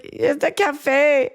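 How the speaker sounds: very slow, high, swooning; drawn out like pure bliss; mock ecstatic. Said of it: "an orgasm while he's eating".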